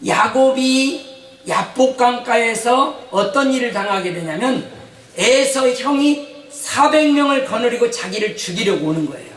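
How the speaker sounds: ordinary delivery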